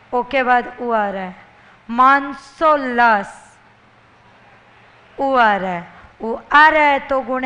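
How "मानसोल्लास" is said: मानसोल्लास slow and louder; syllable by syllable. Shown as a demonstration. Teacher sounding out मानसोल्लास for the class, slowly. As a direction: split मानसोल्लास into slow loud pieces. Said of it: "शब्द बोलिए टुकड़े करके".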